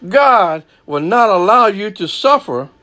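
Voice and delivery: ordinary talk, nothing marked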